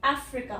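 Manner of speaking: 'अफ्रीका' opening on 'ae', short 'ri'; correct pronunciation. Shown as a demonstration. Teacher stresses the needed first vowel, careful 'अफ्रीका'. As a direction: model 'अफ्रीका' as AF-ri-ka. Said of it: "ए बोलना बहुत जरूरी है".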